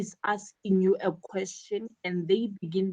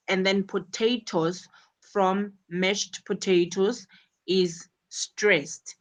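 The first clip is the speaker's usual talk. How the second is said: usual speaking voice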